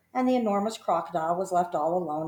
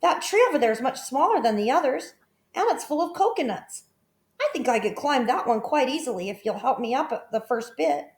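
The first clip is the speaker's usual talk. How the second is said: put-on high, bright child voice, eager; eager boy; 7 semitones higher than usual. A narrator's reading. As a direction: play the boy keen to climb. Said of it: "cried Toto".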